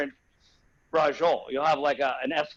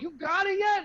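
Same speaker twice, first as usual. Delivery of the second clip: much higher, strained and anxious; panicked question; 3 dB louder than usual. Retold or imitated. Patient to the doctor yanking at him, high panicked plea. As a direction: plead with the doctor to be done.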